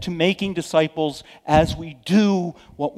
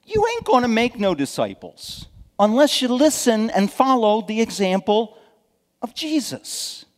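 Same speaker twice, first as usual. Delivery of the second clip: emphatic preaching; 7 semitones higher, 5 dB louder than usual. Real, not acted. Preacher driving final point.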